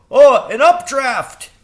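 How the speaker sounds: very high, loud bird exclaim; delighted crow. A narrator's reading. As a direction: cry it out gleeful. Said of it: "he said".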